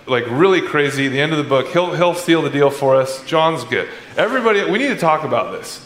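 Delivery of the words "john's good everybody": usual speaking voice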